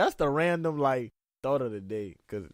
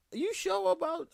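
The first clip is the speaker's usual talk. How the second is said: surprised. Retold or imitated. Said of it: "I'll be like".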